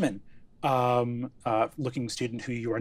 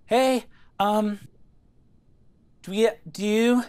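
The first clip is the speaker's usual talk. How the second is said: halting, slightly raised in-character voice; awkward Zelda greeting; 5 semitones higher, 5 dB louder than usual. Acted. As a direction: hesitate and stumble through a friendly introduction. Player voices shy Zelda greeting.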